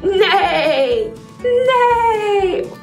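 whinny like a horse; horse neigh. Shown as a demonstration. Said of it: "Let's neigh like a horse together".

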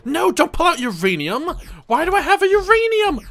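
high, loud dismay; exasperated disbelief. Real, not acted. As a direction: cry out at the mistake. Wrong item pulled out.

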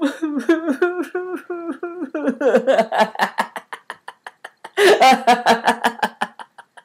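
long loud high belly laugh; laughter yoga laugh. Shown as a demonstration. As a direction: burst into hearty laughter. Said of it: "I turned it into laughter".

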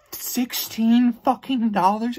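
high, slow, incredulous shout of the price; outraged disbelief. Real, not acted. Ranting vlogger repeats the ticket price, outraged and slow. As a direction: stretch it out, appalled.

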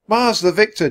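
put-on high, loud boy's yell. A narrator's reading. Jack to the horsemen, yelling the password. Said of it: "Jack yelled".

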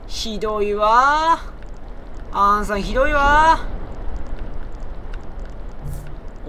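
slow drawn-out "ひどいわー" whine; mock whine. Player whining at a teammate, drawn-out mock hurt. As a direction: drag it out like a hurt complaint.

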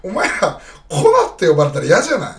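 loud, insistent question; loud appeal. Real, not acted. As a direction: put it straight to the viewers, loud. Pressing viewers about a nickname.

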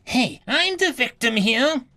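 high, sharp nagging voice of an entitled customer; shrill impatient woman. Acted. Entitled human complaining to the officer, shrill and high. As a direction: snap at her like a spoiled shopper. Temper bomb human protesting.

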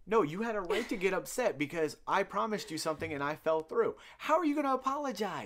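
loud, high mock protest, climbing to the end; playfully worked-up objection; exasperated protest. Acts out his exasperated reply.

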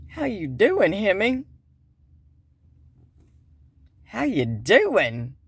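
loud, swooping flirty come-on; mock flirting. Flirting at the man working on his Hemi, hammed-up come-on. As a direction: stretch it out like a hammed-up pickup line.

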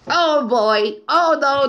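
ordinary talk, nothing marked

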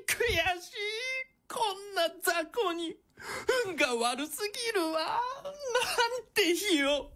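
sobbing at her bad luck; tearful defeat. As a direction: whimper it out, still crying. Defeated villain keeps crying.

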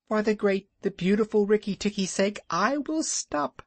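high, chirpy bird voice boasting; little bird Darzee. A narrator's reading. Darzee boasting to Rikki about Nag's death, high and chirpy. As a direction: puff up like a proud tiny bird. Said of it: "said Darzee".

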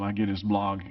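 usual speaking voice

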